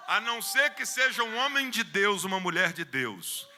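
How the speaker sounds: loud high exclamation; fervent shout; shouted emphasis. Real, not acted. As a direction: call it out. Preacher exclaims.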